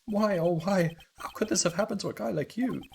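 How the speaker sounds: high-pitched, hammed-up lament; theatrical grief. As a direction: wail it like a stage mourner. Grieving over dead partner.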